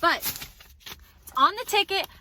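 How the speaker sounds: slow, high indignant complaint. Real, not acted. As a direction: stress how absurd it is. Complaining about wrong order.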